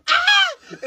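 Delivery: very high startled mock shriek; imitated scream. Startled by girls outside bathroom, very high mock shriek. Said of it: "in this exact same tone".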